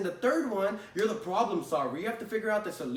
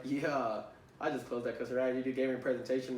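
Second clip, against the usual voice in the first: smug satisfaction; 7 semitones lower, 4 dB quieter than usual. Retold or imitated. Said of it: "sitting back and you're like".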